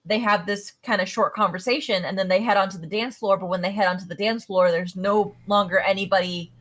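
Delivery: ordinary delivery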